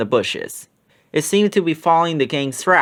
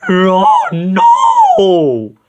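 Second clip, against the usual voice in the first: loud, high cartoon-dog holler; 5 semitones higher, 8 dB louder than usual. A narrator's reading. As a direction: holler it holding on for dear life. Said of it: "Scooby hollered".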